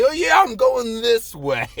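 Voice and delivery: high, loud, drawn-out boast; mock bragging. Traveler trying to impress the girl, playfully boastful. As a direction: stretch it out like a show-off.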